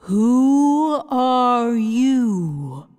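a giant floating head announcing itself slowly; booming Wizard head. A narrator's reading. Giant head greets the visitors, slow and looming.

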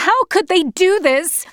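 high-pitched, outraged cry; shout. A narrator's reading. Girl protests to the train car about the beating, shouting. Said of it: "I shouted".

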